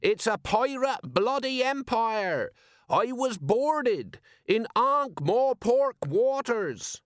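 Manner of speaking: high, slow soapbox complaint; shouted outrage. A narrator's reading. Wronged merchant stirs up the crowd, shouting. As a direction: stretch each word into a high, indignant cry. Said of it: "shouted a speaker opposite him".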